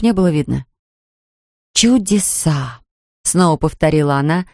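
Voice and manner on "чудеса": slow, drawn-out amazement; spellbound wonder. A narrator's reading. Anfisa watches her reflection, slowly marvelling. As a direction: stretch it out in wonder. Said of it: "заворожённо глядя на себя".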